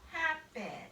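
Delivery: "happen" with HAP accented and -pen falling away; first-syllable stress. Shown as a demonstration. Teacher showing the accented syllable, HAP stressed in "happen". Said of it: "We accent the first syllable".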